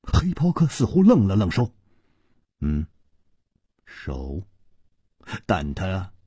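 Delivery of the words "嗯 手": low, quiet, hesitant echo; puzzled villain. A narrator's reading. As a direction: murmur it low, briefly at a loss. Puzzled villain echoes the word. (低声迟疑地重复)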